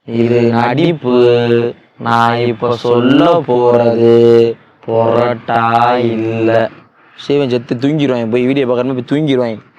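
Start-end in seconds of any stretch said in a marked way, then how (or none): 0.0-6.7 very slow, slightly louder slow-motion speech. Pretend. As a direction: drag every word out like a slowed film. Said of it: "slow motion".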